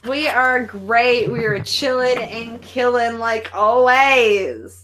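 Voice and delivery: slow, drawn-out cheerful welcome; the host welcoming a new guest, slow and drawn out; slow host greeting. Real, not acted. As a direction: stretch the words out like a show host. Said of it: "host greeting a guest".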